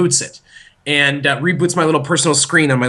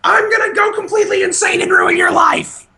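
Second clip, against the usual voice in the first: put-on high, loud crazed kid shout; imitated toddler tantrum; 4 dB louder than usual. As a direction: scream a hammed-up threat as a berserk toddler. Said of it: "six hours of her being like".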